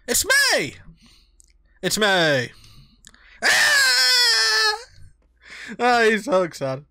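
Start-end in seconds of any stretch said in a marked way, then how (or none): 0.1-0.8 high, eager excited friend; hyper Greg voice. Acted. 3.4-4.7 very high, loud ecstatic scream; joyful scream. Acted. Greg screams with joy.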